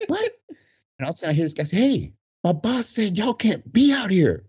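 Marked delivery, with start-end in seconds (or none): 0.1-0.3 very high questioning yelp; mock can't-hear "What?". Retells not hearing over music. 1.8-4.4 high, slow, insistent announcement; retold bouncer's order. Employee telling dancers to get off floor, high and insistent.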